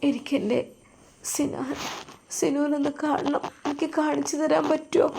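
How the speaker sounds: patient begging the doctor to see her husband, softly crying; crying plea. Acted. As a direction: plead through tears, voice small. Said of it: "അവൾ കരഞ്ഞുകൊണ്ടാണ് അത് ആവശ്യപ്പെട്ടത്".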